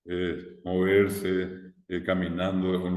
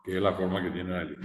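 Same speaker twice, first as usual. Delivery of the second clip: ordinary delivery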